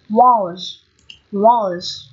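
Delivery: "walls" as "woz", short rounded O, no L; strong form, short O. Shown as a demonstration. Teacher showing the other strong reading, rounded O in "walls". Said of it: "là âm o ngắn".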